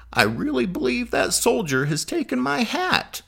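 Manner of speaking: high, put-on old man's voice; old man declaring. A narrator's reading. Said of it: "the old man declaring".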